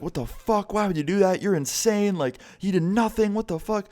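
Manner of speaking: high-pitched mock outrage, not shouted; imitating outraged girlfriend. Girlfriend protests the unprovoked hit, high mock-outraged imitation.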